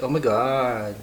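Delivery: put-on drawn-out Valley girl voice; mock Californian girl drawl; California girl. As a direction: do a California girl, vowels stretched. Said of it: "like those girls".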